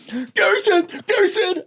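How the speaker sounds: high-pitched calls for mom; calling out. Retold or imitated. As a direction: call her over, alarmed. Girls calling to mother.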